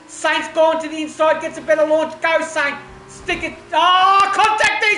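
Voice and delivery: loud, high, racing commentary at full tilt; rising excitement of live race calling; excited play-by-play. Real, not acted. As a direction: call the last lap like a race caller. Live last-lap race commentary.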